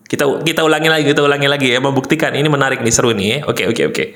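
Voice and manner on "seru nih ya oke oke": usual speaking voice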